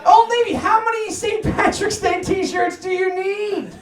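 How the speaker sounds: ordinary delivery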